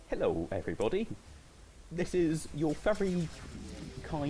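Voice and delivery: lightly voiced cheerful intercom greeting; jerky animatronic voice. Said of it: "Sound like an anime intro".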